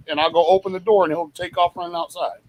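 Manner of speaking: ordinary talk, nothing marked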